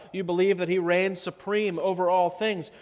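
usual speaking voice

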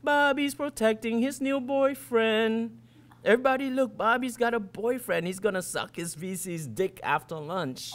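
high, chanting schoolyard jeer; sing-song taunt. Acted. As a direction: tease him in a sing-song for all to hear. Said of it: "in this loud sing-song voice".

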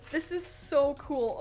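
normal talking voice

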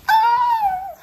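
very high, loud cry of wonder; real loud shriek of amazement; amazed shout. Real, not acted. Opens the curtains to the view.

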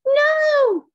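high, loud drawn-out cry of refusal; witch's cry. A narrator's reading. Witch fleeing a hungry dragon, crying out. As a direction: cry out in storybook alarm. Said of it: "cried the witch".

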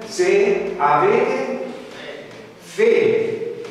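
"se avete" slowed right down, then "fede" punched high and loud; "fede" said slow and emphatic; stress on fede. Real, not acted. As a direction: hold back, then hit "fede" hard. Preacher stresses faith in quote.

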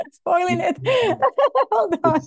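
laughs through the words, then a high burst of laughter; embarrassed real laughter breaking into a squeal; laughing. Real, not acted. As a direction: giggle at your own slip, caught off guard. Laughs at her own screen mishap.

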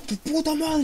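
high, shouted insult; imitated Alex yelling. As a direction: scream it at your dad. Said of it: "Y suena Alex". (Alex gritándole a su padre)

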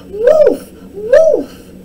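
high, loud fake alarm; screamed alarm. A narrator's reading. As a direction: scream it out to summon the town. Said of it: "screamed at the top of her voice".

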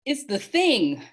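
high, drawn-out cry of alarm. A narrator's reading. Boy spots the dark thing from space, crying out. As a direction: call it out high and urgent. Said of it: "Charles Wallace cried".

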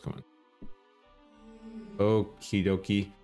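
ordinary talk, nothing marked

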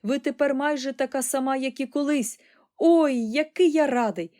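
high, eager voice; exclaiming happy boy. A narrator's reading. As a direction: gush with joy to granny. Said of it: "вигукнув Чарлі".

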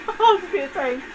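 high, slow, through laughter; laughing. Real, not acted. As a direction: giggle it out in delight. Repeats while laughing.

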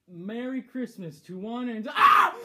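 normal talking voice

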